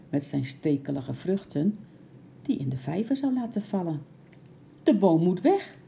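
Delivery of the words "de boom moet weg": loud, raised, agitated voice; agitated exclamation, higher and louder; nervous call. A narrator's reading. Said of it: "riep Bart zenuwachtig".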